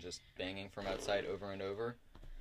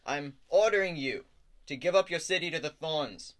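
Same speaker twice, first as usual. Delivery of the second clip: loud, raised-pitch speechifying with grand pauses; pompous troop leader; 6 semitones higher, 7 dB louder than usual. Acted. Troop leader demands the city's surrender, pompous declamation. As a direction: proclaim it in short, commanding bursts.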